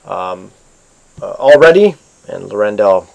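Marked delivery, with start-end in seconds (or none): 1.3-2.0 raised, louder captain's call; captain's mild yell. A narrator's reading. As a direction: call out a check to the crew, half yelled. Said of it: "he, uh, yells out".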